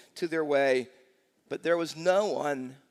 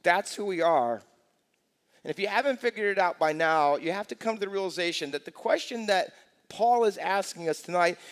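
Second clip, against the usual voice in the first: ordinary talk, nothing marked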